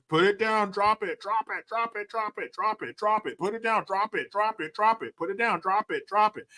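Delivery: high, rapid-fire mock police orders; imitating an insistent officer, pitched well up; police commands. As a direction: rattle off the command over and over without a break. Acting out repeated police commands.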